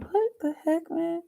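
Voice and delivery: high, thin mock disbelief; incredulous what the heck. Retelling her reaction to a worried stranger, mock incredulous. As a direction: act baffled at a stranger's concern. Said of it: "I was like".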